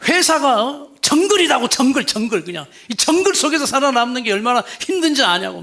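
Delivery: husband yelling that his company is a jungle; husband blowing up. Retold or imitated. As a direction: bark that the office is a jungle. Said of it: "막 버럭버럭했어요".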